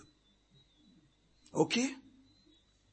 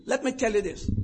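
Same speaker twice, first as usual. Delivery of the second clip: normal talking voice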